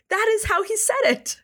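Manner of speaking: very high, incredulous insistence; gleeful insistence. Real, not acted. Host swears the ad copy is real, gleefully insistent. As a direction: pitch it way up, amazed it's true.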